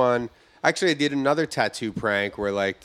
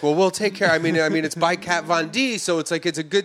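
higher host voice; 7 semitones higher, 3 dB louder than usual. Retold or imitated. Host consoling while stifling laughter.

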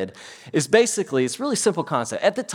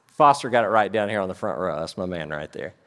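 ordinary talk, nothing marked

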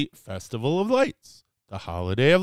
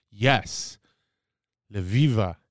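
ordinary talk

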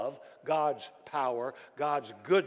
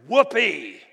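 loud cheer, pitched up; emphatic whoopee; 4 semitones higher, 10 dB louder than usual. Shown as a demonstration. Preacher closing a quoted prayer, loud emphatic cheer. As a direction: cap the prayer with a big whoopee. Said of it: "Yeah, it's emphatic".

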